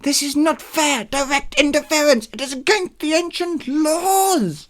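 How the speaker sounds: monster wail. Acted. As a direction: wail the protest like an outraged monster. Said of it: "The monster wailed".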